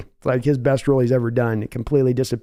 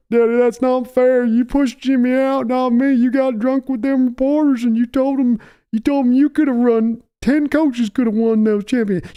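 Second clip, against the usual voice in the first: high, whining grown son pleading; a weak son whimpering to Daddy; whiny son; 9 semitones higher than usual. Acted. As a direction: son whining back at his bullying father.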